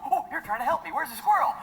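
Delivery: a dog thinking it's being helped; eager dog voice. Pretend. Comic voicing the dog, a hint of eager excitement. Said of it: "He would run up to her and go".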